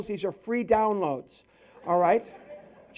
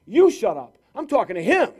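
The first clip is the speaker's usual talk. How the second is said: loud, higher mock-angry comeback; drunk heckler's retort; 3 semitones higher, 8 dB louder than usual. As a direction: snap back loudly, imitating an arguing drunk. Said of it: "He says".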